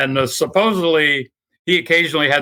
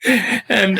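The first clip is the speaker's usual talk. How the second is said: ordinary delivery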